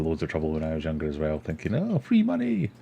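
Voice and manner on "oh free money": high, naive mock glee at free money. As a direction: squeak it out like a gullible kid. Naive younger self retold.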